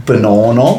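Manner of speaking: mock South African accent on one word. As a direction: say Banana with a jokey South African twang. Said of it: "the South African accent".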